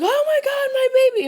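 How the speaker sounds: mother discovering her baby taken, hammed-up high panic. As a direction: shriek it like an over-dramatic mother whose baby is gone.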